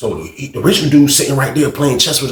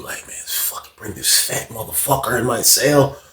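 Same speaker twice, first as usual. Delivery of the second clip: ordinary talk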